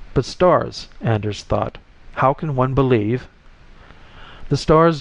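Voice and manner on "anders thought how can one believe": usual speaking voice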